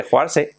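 hoarse as Spanish "jo-AR-se"; pronounced as spelled. Shown as a demonstration. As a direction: say joarse with every written vowel. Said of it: "se escribe joarse". (joarse leído a la española)

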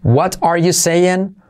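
"What are you saying" slow, every word separate with a clear t in "What"; full form. Shown as a demonstration. Teacher lists forms for learners, fullest "What are you saying". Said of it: "formas distintas".

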